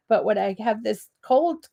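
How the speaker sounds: ordinary talk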